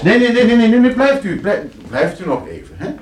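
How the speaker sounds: high, loud rush of no's; urgent pleading. Acted. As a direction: stop him from leaving, loud and high. Gast wil vertrekken, hij houdt hem tegen. (luid en hoog, dringend smekend)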